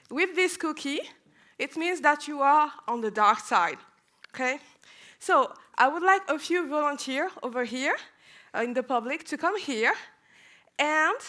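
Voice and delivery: ordinary talk, nothing marked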